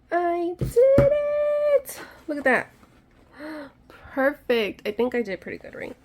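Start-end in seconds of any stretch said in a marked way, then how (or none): 0.1-2.0 very high, louder, slow triumphant squeal; excited squeal. Real, not acted. Thrilled the craft worked. 2.3-4.9 higher and louder, proud and delighted; thrilled. Real, not acted. Showing off result.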